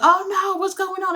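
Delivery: high-pitched imitation of a panicked crowd; exaggerated high-voiced dismay; mock alarm. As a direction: mimic everybody freaking out, voice jumping way up. Mimics the whole crowd's alarm.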